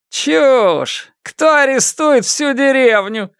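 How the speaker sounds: high, scoffing heckle; mocking. A narrator's reading. Villager answering Фан Му's warning from the crowd, mocking. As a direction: jeer it out from the crowd. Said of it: "насмешливо ответили из толпы".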